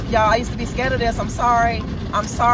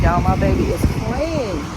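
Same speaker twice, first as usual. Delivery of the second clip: ordinary delivery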